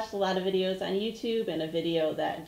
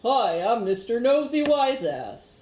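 slow, loud goofy puppet guy, higher; 4 semitones higher, 6 dB louder, slower than usual. Pretend. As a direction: ham up a cheeky puppet character. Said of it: "pretend you're playing with the guy puppet voice".

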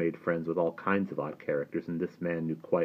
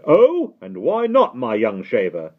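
high, slow, loud mock question; jeering gatekeeper; 5 semitones higher, 11 dB louder, slower than usual. A narrator's reading. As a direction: taunt the boy. Gatekeeper mocks Simon.

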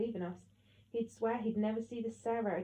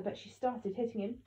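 ordinary delivery

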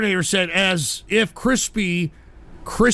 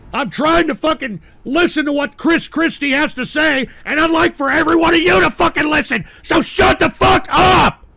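angry rant building to a full shout; exasperated shouting; 6 semitones higher, 4 dB louder than usual. Real, not acted. Streamer fighting chat to hear Chris Christie, angry shouting. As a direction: lose patience, higher and louder to the last word. Scolding chat for interrupting broadcast.

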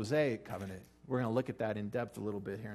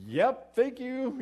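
much higher, louder, slow mock thank you; sarcastic reply; 13 semitones higher, 8 dB louder, much slower than usual. Firework neighbor answering the complaint, slow mock politeness. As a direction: drag out a goofy fake-polite reply.

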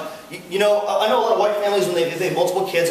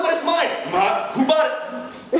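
higher, laughing mock whine; whiny teen protest; 6 semitones higher, slower than usual. Teen argues with mom over the car, laughing whine. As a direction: play the kid protesting, through laughter. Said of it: "you'll be like".

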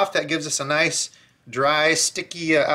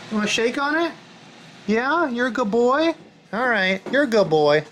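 higher sing-song voice for the dog; praising the dog in a higher pet voice; doggy talk; 6 semitones higher than usual. Real, not acted. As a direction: coo at the dog like a pet owner. Talking to the dog Stubby.

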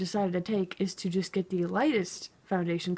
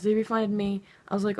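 ordinary talk

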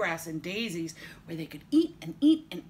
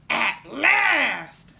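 loud, raised, triumphant troll; 5 semitones higher, 11 dB louder than usual. A narrator's reading. Troll seeing his meal arrive, roaring. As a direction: roar it with relish. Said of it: "said the troll".

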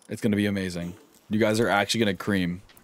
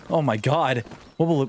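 ordinary talk

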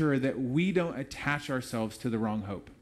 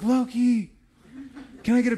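calling out high and loud to a hero; a fan calling Loki over for a picture; excited call; 9 semitones higher, 6 dB louder than usual. Retold or imitated. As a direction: shout eagerly across the park for a photo. Calls to costumed character excitedly.

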